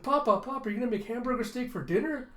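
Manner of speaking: put-on high, eager little-kid voice; high-pitched childlike excitement; excited child. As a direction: beg your dad with delight. Child excitedly asks father about dinner.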